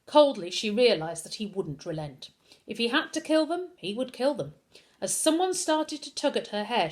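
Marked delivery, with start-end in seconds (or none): none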